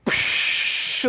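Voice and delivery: long hissing "psh" of spraying water; whooshing jet of water; imitated fountain jet. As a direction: shoot out one sustained spray noise. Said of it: "Bellagio fountain at Las Vegas".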